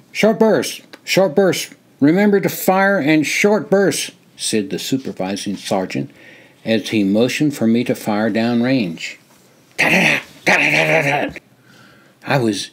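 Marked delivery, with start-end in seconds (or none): 0.1-4.1 high, urgent drill-range call; sergeant's called-out order. A narrator's reading. Supervising sergeant calls out firing order. 9.8-11.4 high, rapid-fire gun chatter; machine-gun imitation. A narrator's reading.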